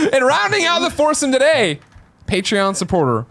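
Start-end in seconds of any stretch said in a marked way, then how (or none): 0.1-1.8 laughing. Real, not acted. Host introduces guest, laughing.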